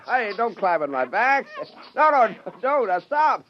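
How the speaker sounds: startled as they clamber on him; laughing alarm. Acted. Scientist protesting creatures climbing his back, flustered.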